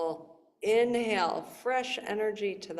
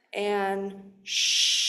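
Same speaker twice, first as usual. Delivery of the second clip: shushing sound. Shown as a demonstration. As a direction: shush like at a library. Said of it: "the exhale sound is like you're shushing somebody".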